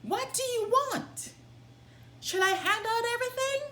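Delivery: high, fast calling out to the thieves; loud call. Acted. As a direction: shout it out eagerly, high and quick. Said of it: "he called out in a loud voice".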